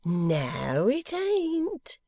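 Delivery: gleeful denial leaping high at the end; high, teasing exclamation; delighted cry. Acted. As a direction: crow it, swooping right up. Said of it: "cried Missus Boffin".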